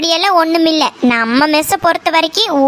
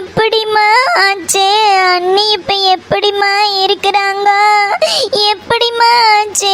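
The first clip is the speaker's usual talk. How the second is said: shocked wailing; 3 semitones higher than usual. Acted. Daughter hearing of her sister-in-law's loss, shocked wailing. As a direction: cry out in shock, wailing each question.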